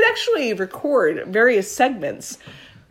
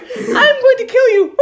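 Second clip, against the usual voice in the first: put-on high, slow, louder creepy child threat; 14 semitones higher, 5 dB louder, slower than usual. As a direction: menace like a horror movie kid. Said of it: "It's creepy 'cause it sounds, like, childlike".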